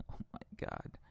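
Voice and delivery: breathless whispered disbelief. Real, not acted. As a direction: murmur it under your breath. Whispered disbelief after quote.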